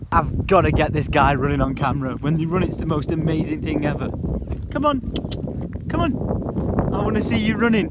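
loud, excited calling; urging. Real, not acted. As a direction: egg the animal on at volume. Urging an animal to run.

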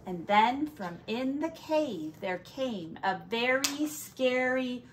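ordinary delivery, nothing marked

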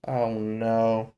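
low, hushed "oh no"; sinking dread. Real, not acted. Viewer realizing he will be forced, quietly dismayed. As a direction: let it drop softly in dismay. Dreading what happens next onscreen.

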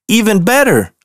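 high, delighted exclaim; delighted at his own grin; pleased surprise. Acted. Bear happy with his growing smile.

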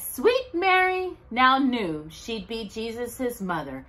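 ordinary delivery

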